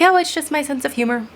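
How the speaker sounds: high, breezy imitation of a friend; mock dismissive excuse. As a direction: brush it off like the friend did.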